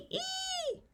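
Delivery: put-on very high, soft baby squeal; toddler screech. A narrator's reading. Baby keeps squealing in the chaos: very high squeal. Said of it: "Tootsie kept it up".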